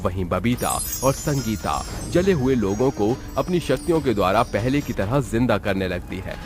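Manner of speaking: ordinary delivery, nothing marked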